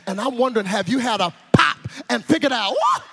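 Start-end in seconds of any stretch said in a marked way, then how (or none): 2.7-3.0 very high, sudden woo; short squealed woo; whoop of discovery. Acted. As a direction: pop out a startled high whoop.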